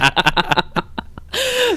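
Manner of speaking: real high-pitched giggling fit; high burst of laughter; laughing. Real, not acted. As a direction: crack up at the struggling bird. Laughing about the windblown bird.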